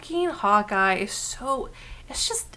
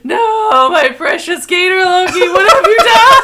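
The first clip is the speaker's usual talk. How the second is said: high, loud wailing through laughter; mock anguish; 9 semitones higher, 11 dB louder than usual. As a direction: cry out as if heartbroken. Mock despair at partner.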